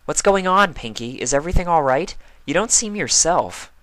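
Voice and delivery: worried young mare, lightly voiced; Twilight voice. Twilight's voice in story.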